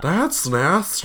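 slow, high, drawn-out camp voice; Mister Slave. Retold or imitated. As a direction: camp cartoon impression, stretched out. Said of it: "something like that".

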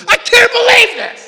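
high, loud mock outrage; mock freakout. A convert losing their mind, loud high shriek. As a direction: shriek it in hammed-up disbelief. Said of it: "losing their mind".

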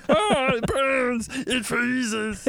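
high, slow, strained creature whine; terrible Gollum. Retold or imitated. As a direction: croak it like Gollum in pain. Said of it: "That's my terrible Gollum there".

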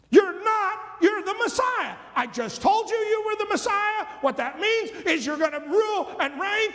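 brash rebuke. Acted. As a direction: scold your leader, all bluster. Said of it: "He starts rebuking Jesus".

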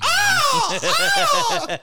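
sudden high mock shriek of fright; mock scream. Diner startled by dinosaur at steakhouse, shrieking in mock fright. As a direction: shriek as if a dino just popped up. Acting a dinosaur scare at table.

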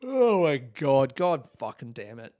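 normal talking voice

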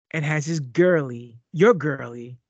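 normal talking voice

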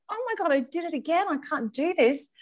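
high, quick happy-mummy voice; put-on sing-out mummy voice over rising panic; mock happy mummy. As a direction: despair disguised as a cheery mum, acted out. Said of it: "trying to be happy mummy".

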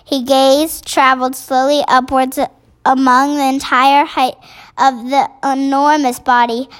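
ordinary delivery

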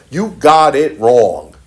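very slow, gentle correction; father's slow reassurance to his son; tender father. Retold or imitated. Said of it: "what did the father say".

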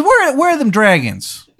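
high, loud, badly faked Maryland voice; terrible Maryland accent. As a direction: ham up a cartoonish Maryland accent. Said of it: "sounds like a bad impression of a Maryland accent".

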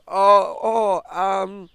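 flustered and high, fumbling for an answer. Retold or imitated. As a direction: caught off guard, stammering out an order. Girl flustered by the barista.

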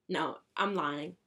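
normal talking voice

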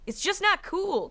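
slow, high line landing on "cool"; stress on "cool". A narrator's reading. Olaf dismissing the elders' objection, stress on "cool". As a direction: stretch out "cool" in a put-on high voice. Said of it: "snapping his fingers again".

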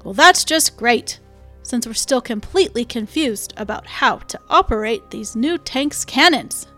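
sarcastic complaining. Acted. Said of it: "one of Tenney's fellow tank men complained".

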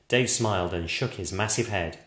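usual speaking voice

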